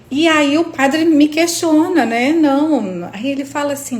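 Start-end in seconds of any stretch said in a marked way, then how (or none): none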